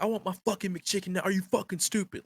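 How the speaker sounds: high-pitched imitation of an irate customer; mock angry yelling. Customer berating a young girl at the window, mock yelling. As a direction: rant high at ordinary volume, as a retold yell. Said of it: "yelling at this girl".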